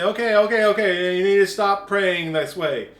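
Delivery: loud scolding call to stop; preacher loudly scolding a congregation; loud mock scolding.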